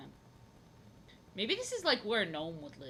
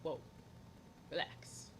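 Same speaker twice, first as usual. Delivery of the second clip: soft retold Whoa relax; 4 semitones lower, 10 dB quieter, slower than usual. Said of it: "and I was like".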